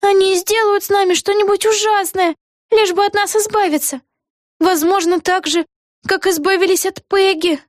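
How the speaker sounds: put-on high girlish voice; anxious heroine. A narrator's reading. Vega fearing they will be got rid of, high anxious girlish voice. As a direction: worry in a high little-girl voice.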